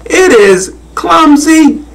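very high, drawn-out jeer; mocking howl. A narrator's reading. Monkeys in trees jeering the giraffe, high mocking howl. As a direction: screech it out mockingly, slowing on the insult. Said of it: "the more they howled".

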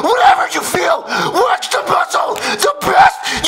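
worked-up shouting; ranting shout. Real, not acted. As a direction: yell it like you're losing it. Peak of shouted rant.

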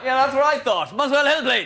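spring the trap on the cabbie; triumphant mock retort. Passenger getting one over the cabbie, gleeful. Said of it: "I go".

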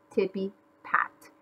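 ordinary delivery, nothing marked

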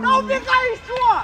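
high, loud, slow urging yell; yelling from the sidelines. Real, not acted. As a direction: yell at him to run. Coach urging player to run. (крик с бровки)

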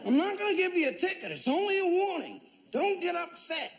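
high, soothing mock officer voice; officer talks down a drunk driver, high and soothing; calming officer.

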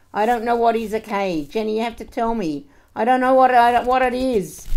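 loud, high, insistent confusion; frantic mother. Acted. Mother begging Jenny to sort the accounts, frantic and loud. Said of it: "my mother in her restaurant doing her accounts".

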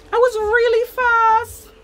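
high, loud and drawn out; proud boast pitched right up, stretched slowly; excited boast. Real, not acted. Telling of discovering a talent for sprinting, excited boast.

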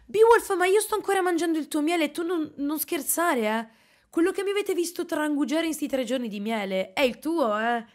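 high, loud playful call to a friend; calling out excitedly. Real, not acted. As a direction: shout a friendly tease at Beowulf. Calling out to a chat donor. (richiamo allegro e acuto)

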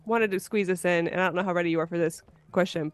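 normal talking voice

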